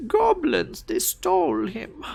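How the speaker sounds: high-pitched kobold grievance; angry little kobold. Acted. Kobold accusing the goblins of theft, mildly angry squeak. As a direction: blame them in a high little creature voice. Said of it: "angry look on his face and he goes".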